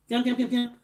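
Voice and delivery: quick repeated dialling beeps; sing-song little phone beeps; imitated phone dialling. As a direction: mimic keypad tones with the mouth.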